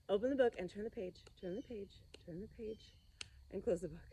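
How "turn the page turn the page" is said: playful whispered pages. Acted. Teacher playing the book game with kids, whispering. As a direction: hush it like a secret.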